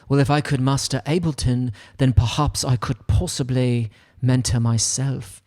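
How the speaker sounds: lightly put-on English accent, playful musing. Imagining a viewer's thought, in a light English accent. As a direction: muse aloud in a mock English accent. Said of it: "I really love doing an English accent".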